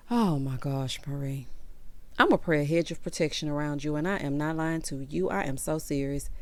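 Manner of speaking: quiet, low, heavy-hearted; hushed sympathy for Marie; sorrowful. Real, not acted. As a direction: soften down in real sorrow. Shocked by shooting news.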